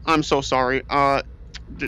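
normal talking voice

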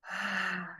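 sighed "Ah" on the out-breath; energised exhale sigh. Shown as a demonstration. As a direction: release the breath with some sound. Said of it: "bring some energy to that exhale".

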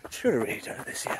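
whispered regretful aside. Real, not acted. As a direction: drop to a whisper.

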